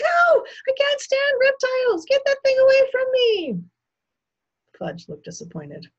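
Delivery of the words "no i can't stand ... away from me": very high, loud horrified shriek. A narrator's reading. Guest recoils from a turtle under her nose, shrieking. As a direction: shriek in sheer revulsion. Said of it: "Missus Yarby shrieked".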